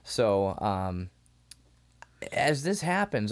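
ordinary talk

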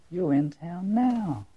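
slow, drawn-out and tender, lingering on each word; savoring the line. Shown as a demonstration. Preacher savoring the woman's line to Nelson, slow and tender. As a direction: let every word sink in slowly. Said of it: "the most powerful line in this story".

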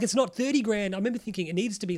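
usual speaking voice

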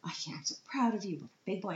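put-on high quick praise for a little boy; proud praise. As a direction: gush over your son. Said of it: "and I'm like".

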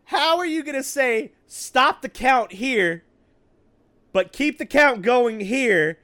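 high, loud, drawn-out exasperation; loud, high-pitched disbelief; incredulous mockery. Real, not acted. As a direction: pitch it up and stretch out the absurd demands. Exasperated mockery of contradictory demands.

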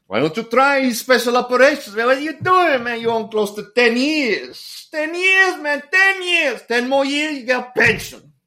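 put-on Raul pushing hard in a very high Puerto Rican accent; Puerto Rican friend impression. Raul urging his friend to try special operations, high Puerto Rican accent. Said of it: "butchering your, the imitation".

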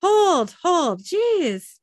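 high, loud panicked protest; hammed-up startled yelps; acted alarm. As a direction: plead with a gunman to stop. Orc panics at the gunshot.

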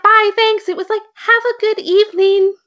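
put-on high, sweet little-kid voice; mock polite kid. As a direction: imitate a tiny polite Spider-Man. Imitating polite kid Spider-Man.

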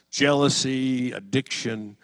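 ordinary delivery

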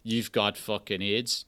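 slow, low, soft and unmoved; monotone. Shown as a demonstration. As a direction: break grim news with no change of tone. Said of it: "there's no change of tone".

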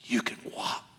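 put-on soft breathy whisper to a baby; hushed encouragement to take a first step; coaxing whisper. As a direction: parents coaxing a toddler in a hush. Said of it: "and they said".

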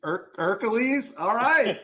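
high, loud startled repeat; surprised. Real, not acted. Host reacts to Rob's burger pick with high surprise. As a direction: hear it and balk in disbelief.

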